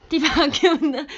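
what on earth, blurt it out; loud puzzled query. Real, not acted. Streamer puzzling over a fan's odd comment, loud.

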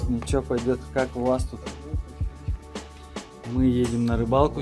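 usual speaking voice

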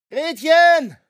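panicked scream for the healer; roaring a name. A narrator's reading. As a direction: bellow her name for help. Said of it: "brüllte ich".

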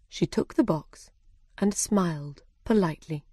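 ordinary delivery

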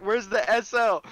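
loud, high urgent yell; shouting. Real, not acted. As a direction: shout for the leader over gunfire. Shouting for squad leader under fire.

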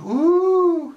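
very high, loud squealed ooh; hammed-up ooh. Fanboy unboxing his watch on camera, hammed-up squeal. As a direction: swoon over the new watch.

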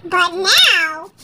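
ordinary delivery, nothing marked

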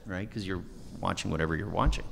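ordinary talk, nothing marked

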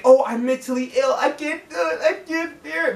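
hammed-up tearful whine; imitating someone crying over their own excuses; mock helplessness. Mocking people who blame therapy-speak for flaws, in a fake tearful whine. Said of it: "as a way of saying".

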